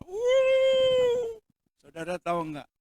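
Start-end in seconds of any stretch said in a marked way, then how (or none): 0.1-1.4 excited cheer. Retold or imitated. Crowd cheering golf shot.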